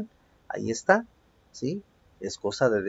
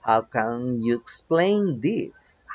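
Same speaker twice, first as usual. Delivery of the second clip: slow, stretched-out example question; slow model reading; much slower than usual. Shown as a demonstration. Teacher reads an example to students, slowly word by word. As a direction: draw out each word for learners.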